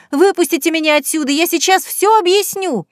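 high, loud, fast pleading cry; desperate cry. A narrator's reading. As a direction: yell to be let out. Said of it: "с криком".

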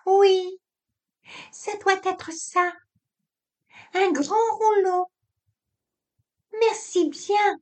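aiguë, polie, petite voix de téléphone. Acted. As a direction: une voix fluette et sage pour la concierge. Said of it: "Cette petite voix que tu fais". (petite voix aiguë de téléphone)

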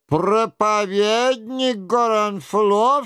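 high, drawn-out exclamation; a king's astonished-sounding cry; exclaiming. A narrator's reading. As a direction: cry it out high and slow, without extra volume. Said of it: "вскричал Генрих".

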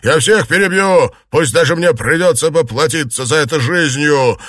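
loud, high angry threat; furious shout. A narrator's reading. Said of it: "закричал Цзялянь".

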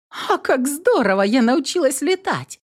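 high, thrilled cry of joy; delighted exclamation. A narrator's reading. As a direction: burst out in delight. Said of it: "восторженно воскликнула".

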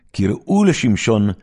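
loud, high jeering summons; festive call. Acted. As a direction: call him out to mock him. Merry crowd calls for Samson. (קריאה עליזה ולועגת)

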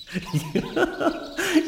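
high burst of laughter; high, mocking chuckle mid-argument; scornful laugh. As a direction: laugh out at the absurd idea. Scornful laugh amid heated argument.

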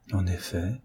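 조용히 중얼거리듯, 혼잣말처럼; murmured. A narrator's reading.

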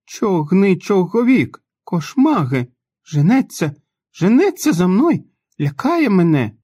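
high, loud panicked cries; a scared boy shouting that it's chasing him; terrified child shouting. A narrator's reading. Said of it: "Він зірвався на ноги та виставив руки". (наляканий дитячий крик)

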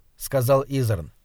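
ordinary delivery

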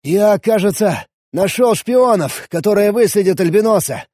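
bursting with a discovery; excited. A narrator's reading. Excited about his find.

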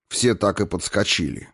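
ordinary delivery, nothing marked

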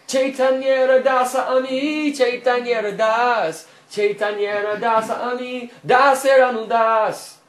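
slow, loud, high chanted declaration; loud proclamation. Acted. Advaita loudly proclaiming himself the servant of Chaitanya. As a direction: proclaim it to the whole world. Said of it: "used to loudly proclaim".

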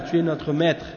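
ordinary talk

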